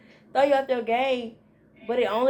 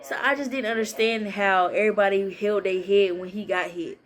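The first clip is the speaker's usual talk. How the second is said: ordinary talk, nothing marked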